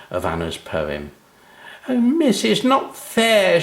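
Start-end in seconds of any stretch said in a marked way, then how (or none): 1.9-3.6 wail. A narrator's reading. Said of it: "she wailed".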